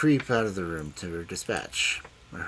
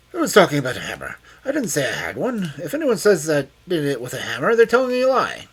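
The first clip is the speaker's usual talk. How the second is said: loud, fast, high defensive denial; wild cunning denial; 8 semitones higher, 10 dB louder, faster than usual. A narrator's reading. Old man lashing out at Loveday, loud and fast. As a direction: deny it wildly and cunningly. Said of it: "wild, cunning look".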